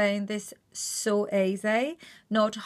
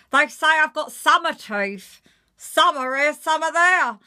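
higher, louder self-mocking Janet; louder, higher London quip; Janet Street Porter joke; 7 semitones higher, 8 dB louder than usual. Shown as a demonstration. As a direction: tell the teeth joke as Janet. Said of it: "obviously I'm known for my teeth".

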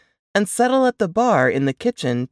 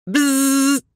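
high, flat buzzing; buzzer sound; 10 semitones higher, 3 dB louder than usual. Acted. Narrator mimicking the intercom buzzer, high buzzing. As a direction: imitate an intercom buzz.

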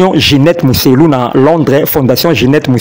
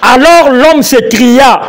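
Bible reading; 7 semitones higher, much slower than usual. Acted. Co-host reads the verse aloud to the pastor, slow and solemn. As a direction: proclaim the verse slowly from the pulpit.

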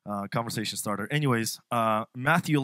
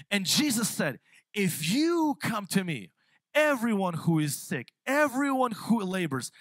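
louder, slower impassioned sermon; a louder, impassioned preaching run with stretched phrases; building preaching; 2 semitones higher, 4 dB louder, slower than usual. Acted. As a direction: preach it with rising fervor.

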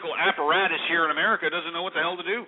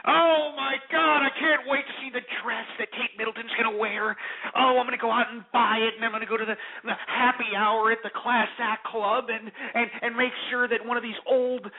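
mock gushing fan; 7 semitones higher than usual. Mocks fans gushing over the wedding.

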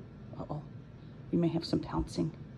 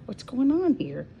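high pet voice; 6 semitones higher, 5 dB louder, slower than usual. Real, not acted. High voice talking to the cats.

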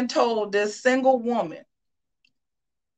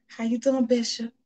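sweet-talking; 5 dB quieter than usual. Pretend. Wife sweet-talking husband.

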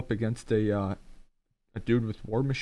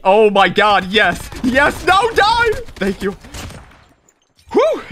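loud high victory shouts; triumphant yelling; 10 semitones higher, 11 dB louder, slower than usual. Real, not acted. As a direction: scream with relief and triumph. Winning the fight.

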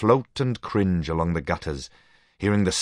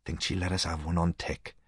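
low and hushed, much softer; 8 dB quieter than usual. A narrator's reading. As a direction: ask quietly so the barmaid won't hear. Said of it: "Leslie said in a low voice".

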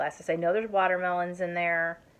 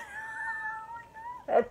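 squealing giggle up high; high burst of laughter; 4 dB quieter than usual. Real, not acted. Laughs at own outburst.

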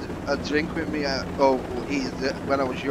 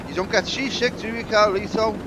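higher-pitched teasing question; imitated needler, pitched up; needling film lawyer; 7 semitones higher than usual. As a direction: retell it as a high-voiced needling lawyer. Acts out film character needling Carlito.

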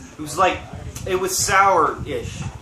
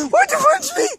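high, stunned laughing protest; shocked disbelief. Real, not acted. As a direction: demand it in shock, pitch way up. Asks why he was punched.

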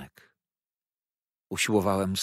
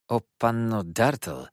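raised, louder acted protest; shocked reproach; 4 semitones higher, 5 dB louder than usual. A narrator's reading. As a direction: break in to shame her. Narrator interrupts Rose in protest. (oburzony, podniesiony protest)

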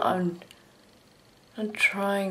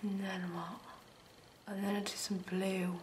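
whispering; 15 dB quieter than usual. Real, not acted. Muttering while doing makeup.

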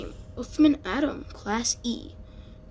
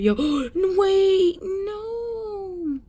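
gasping, higher cries of alarm; panicked gasp; 9 semitones higher, much slower than usual. Real, not acted. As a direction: gasp and protest in sudden panic. Panic at game event.

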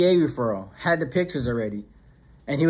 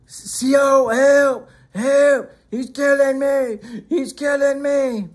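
high, drawn-out cries for rescue; imitated screaming for help; 9 semitones higher, 4 dB louder, much slower than usual. Imitating Termite calling the tower guard from his cell, screaming for help. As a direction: holler slowly to a distant guard tower, high. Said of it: "Then Termite started screaming".

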